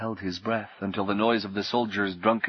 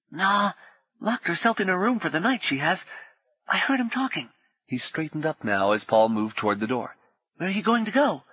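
put-on much higher boy's voice, hushed and quick; urgent potboy; 8 semitones higher than usual. Potboy warning Paul of soldiers, high and hushed. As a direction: warn him like a frightened street boy.

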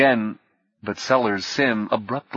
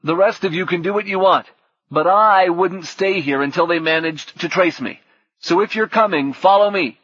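much higher, louder rallying call; 8 semitones higher, 4 dB louder than usual. A narrator's reading. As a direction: raise the voice to lead them. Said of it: "Orlando made his voice louder".

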